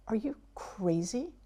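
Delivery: whispered, shocked disbelief; an appalled whispered reaction; hushed disbelief. Retold or imitated. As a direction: hiss it in horrified disbelief. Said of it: "you're not talking like that".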